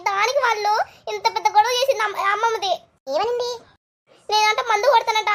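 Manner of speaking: ordinary talk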